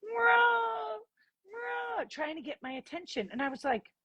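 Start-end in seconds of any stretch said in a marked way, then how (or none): 0.0-2.0 high, drawn-out dog whine; whining dog. Retold or imitated. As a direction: whine like a dog begging for attention. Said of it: "she was whining like this".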